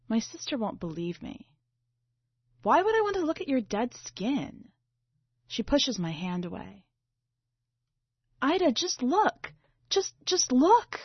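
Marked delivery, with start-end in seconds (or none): 2.6-4.7 disgusted sister. Acted. Sister snaps at narrator. 8.4-11.0 pleading. Acted. Narrator begs sister to look.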